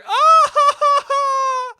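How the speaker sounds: very high, loud mock shriek; aunt's shriek. Imitating his loud wide-eyed aunt, high shriek. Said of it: "very wide-eyed and just like".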